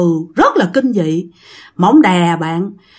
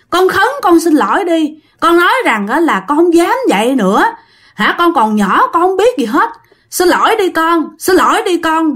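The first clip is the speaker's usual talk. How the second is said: higher, urgent coaxing voice of an unseen man; pleading uncle; 7 semitones higher than usual. Retold or imitated. Unseen uncle urging the girl to apologize, pleading. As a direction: beg her gently but urgently to apologize. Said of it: "một giọng của một người đàn ông".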